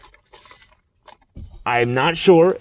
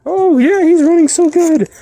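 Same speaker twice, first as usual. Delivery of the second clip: much higher and louder sugary mock gush; mocking fake fans; 5 dB louder than usual. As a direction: parody of fair-weather fans. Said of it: "And you're gonna try and".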